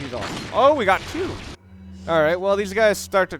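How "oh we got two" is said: sudden high, loud "oh, we got two!"; startled yelp of surprise; surprised exclamation. Real, not acted. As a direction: caught off guard by a second enemy. Surprised at two enemies appearing.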